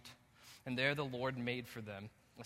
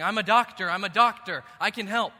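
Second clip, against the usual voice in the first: loud, higher, urgent call for attention; urgent shout; 8 semitones higher, 18 dB louder than usual. Pretend. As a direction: burst in shouting that you can help. Someone rushing in, saying.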